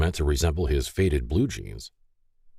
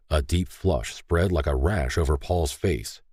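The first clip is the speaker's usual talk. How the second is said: plain reading voice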